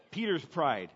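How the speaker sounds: ordinary talk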